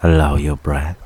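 normal talking voice

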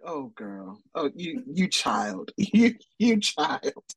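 slow, mocking brooding lover; cheesy mock Angel. Mocking Angel's cheesy line to Buffy, slow and hammed-up. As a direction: ham up the cheesy line, drawn out. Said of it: "it's so cheesy".

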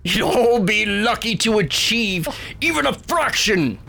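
big, booming god proclaiming to all. Acted. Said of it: "booming voice says".

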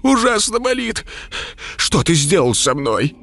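pained wail. Acted. Tortured dead head crying out.

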